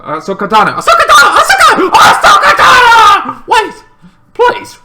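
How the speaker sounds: laughing mock shout for someone leaving; desperate shouting. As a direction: chase her down, yelling her name. Calling after Ahsoka.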